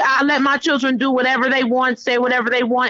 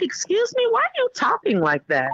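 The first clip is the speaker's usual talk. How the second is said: high incredulous start that drops down; affronted mother; 11 semitones higher than usual. Retold or imitated. Mother to son who talked back, high affronted disbelief. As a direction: imitate a mother taken aback, starting way up.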